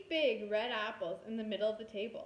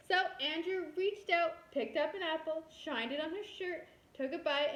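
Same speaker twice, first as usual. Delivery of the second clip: ordinary delivery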